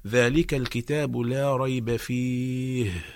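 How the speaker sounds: ذلك الكتاب لا ريب فيه slow, each letter from its point; slow exact Quran recitation; careful recitation. Shown as a demonstration. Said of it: "الصحيح أن نقول".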